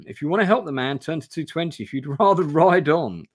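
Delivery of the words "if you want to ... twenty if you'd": ordinary delivery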